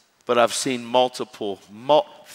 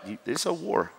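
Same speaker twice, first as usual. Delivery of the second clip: ordinary delivery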